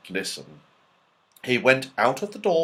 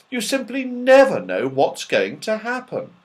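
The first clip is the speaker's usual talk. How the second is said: put-on higher, bright child's wonder; delighted child; 11 semitones higher, 4 dB louder than usual. A narrator's reading. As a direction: marvel at the tree. Franny delighted by the tree.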